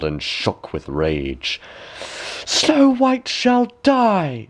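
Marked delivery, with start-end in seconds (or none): 2.5-4.5 high, very slow raging vow; high, slow furious queen; furious cry. A narrator's reading. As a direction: spit it out in jealous rage. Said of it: "she cried".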